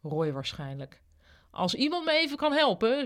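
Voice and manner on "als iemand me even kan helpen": calling out. A narrator's reading. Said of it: "riep Enzo".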